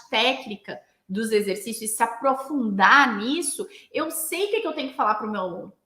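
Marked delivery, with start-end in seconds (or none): none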